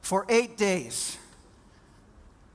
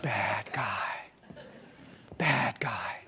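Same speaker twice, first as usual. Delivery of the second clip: a rueful whisper, lower; 7 semitones lower, 8 dB quieter, slower than usual. Acted. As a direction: breathe it to yourself, rueful. Speaker feeling cast as villain.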